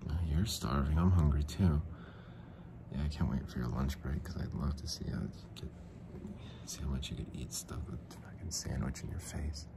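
creep voice. Pretend. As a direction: breathe it out like a perv. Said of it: "Creep, go".

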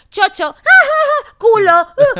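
put-on high, loud giggly kid blurting rude words; childish schoolyard taunt. Friend mocking schoolyard rudeness, loud giggling kid voice. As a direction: squeal it like a cheeky schoolkid, cracking up. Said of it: "como en el cole cuando decías".

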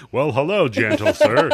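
hammed-up high, loud gentlemanly hello; Tim Yebo impression. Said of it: "he's all like".

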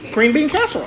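high giggly words through laughter; laughing. Real, not acted. Laughing joke line.

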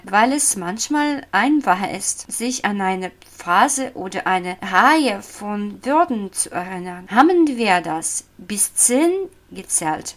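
usual speaking voice

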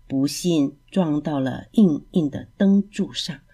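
ordinary delivery, nothing marked

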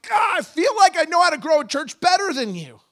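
loud, high cry out to God. Retold or imitated. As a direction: shout it up to God in frustration. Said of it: "I was like".

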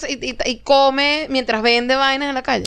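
ordinary delivery

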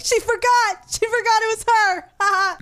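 high-pitched, gleeful mock announcement; imitating amused parents. Parents telling the neighborhood friends, mock high-pitched glee. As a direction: crow it like a tickled parent. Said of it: "They thought it was so funny".

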